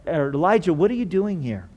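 soft, gentle question; quiet fatherly concern; tender. Shown as a demonstration. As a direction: ask it softly, without judgment. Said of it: "It's the same tone".

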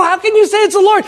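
loud, high-pitched disbelief; incredulous challenge. Retold or imitated. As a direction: challenge him, voice shooting up. Speaker challenges pastor's claim.

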